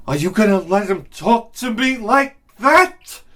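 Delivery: mock a wife egging her husband on; indignant wife. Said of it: "does the classic fucking woman move".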